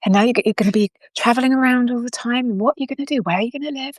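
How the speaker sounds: high-pitched, hurried worrying grandma; fretting grandma. Acted. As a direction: imitate a grandma fussing over every what-if. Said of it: "wringing her hands".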